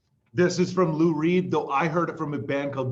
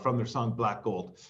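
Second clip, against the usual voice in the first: usual speaking voice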